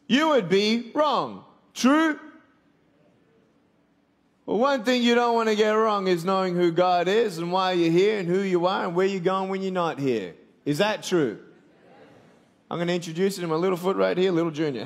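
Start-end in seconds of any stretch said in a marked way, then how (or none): none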